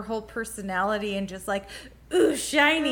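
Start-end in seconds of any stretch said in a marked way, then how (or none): 2.1-2.9 higher, louder distracted delight; light impression of easy wonder; mock fascination. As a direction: coo at a glittery thing, playfully imitated. Imitating Winter's curiosity.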